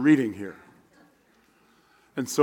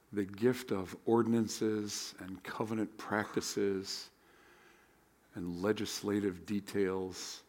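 prayer; 3 semitones lower, 8 dB quieter than usual. Acted. Leads opening prayer.

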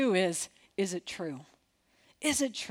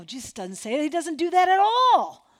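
normal talking voice

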